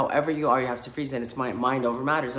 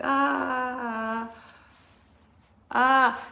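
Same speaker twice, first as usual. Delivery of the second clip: high, loud imitated scream; mock scream; 12 semitones higher, 4 dB louder than usual. Retelling the retreat, a high mock scream. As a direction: let out a hammed-up high scream. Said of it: "I'm like".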